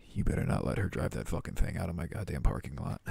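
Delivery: low, quick threat; retold stern warning. Warning someone off driving the car, low and stern.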